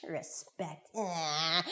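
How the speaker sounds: soft, dismissive mutter; quiet scoff; scornful little boy. Acted. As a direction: grumble the word to yourself. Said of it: "He's like".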